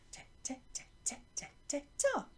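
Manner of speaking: whispering the rhythm under the class; breathy whispered chant beside pupils; whispered along. Shown as a demonstration. Teacher whispering along as pupils chant. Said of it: "then it's you".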